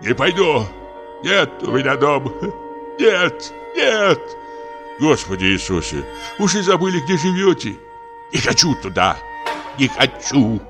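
high-pitched, desperate refusal; distraught old actor crying out in refusal; frantic protest, breaking into wails; frenzied. A narrator's reading. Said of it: "бормотал комик в каком-то исступлении".

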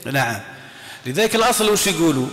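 normal talking voice

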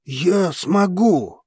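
ordinary delivery, nothing marked

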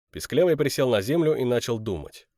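plain reading voice

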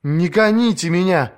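high, urgent plea; pleading. A narrator's reading. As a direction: beg him not to send her away. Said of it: "взмолилась она".

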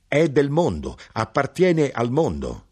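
elated knife-grinder driving the point home; delighted insistence. A narrator's reading.

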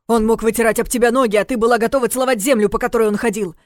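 loud, high heated rant; angry. Acted. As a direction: fire it back in anger. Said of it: "Кирилл тоже разозлился".

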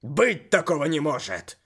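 angry denial. Acted. Main refuses to believe.

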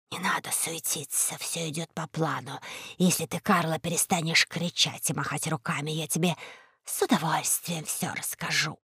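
very soft, low rustling voice; hushed, low reassurance; Шрип. A narrator's reading. Rope calms the shouting Карло, rustling and hushed. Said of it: "прошелестела верёвка Шрип".

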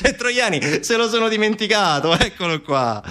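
high-pitched ironic exclamation; mock discovery. Narrator mocks police list missing Troiani, ironic.

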